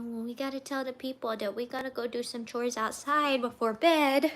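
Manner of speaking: ordinary talk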